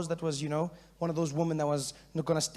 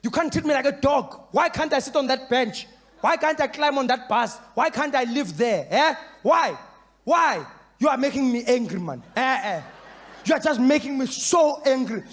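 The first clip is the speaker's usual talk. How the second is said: higher, louder protest rant building to a shout; angry protester; 7 semitones higher, 5 dB louder than usual. Retold or imitated. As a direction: rant at the bench and bus rules, climbing to a yell. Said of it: "The first guy standing there going".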